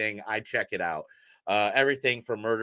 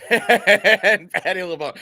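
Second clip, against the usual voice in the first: laughing; 7 semitones higher, 7 dB louder, slower than usual. Real, not acted. Laughing while naming the cast.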